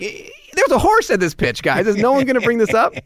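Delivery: mock-frantic; exasperated disbelief. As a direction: beg someone to admit the absurdity. Asking colleagues about the horse.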